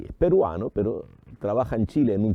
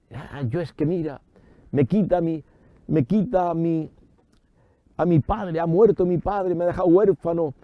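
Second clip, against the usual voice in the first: high-pitched, halting complaint; mock lament; 6 semitones higher than usual. Preacher mimics a believer who lost a father, mock lamenting. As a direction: imitate an orphaned complainer in a raised pitch. Acting out orphan blaming God.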